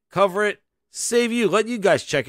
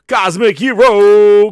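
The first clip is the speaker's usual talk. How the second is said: announcer callout; 3 semitones higher, 11 dB louder, much slower than usual. Acted. Calling out viewer name.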